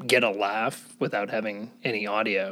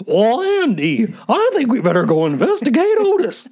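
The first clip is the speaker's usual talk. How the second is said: Barney Fife; 11 semitones higher, 7 dB louder than usual. Retold or imitated. He does the nervous deputy talking to his sheriff. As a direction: squeak it like a jittery small-town deputy. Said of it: "I don't know if I can do a Barney Fife".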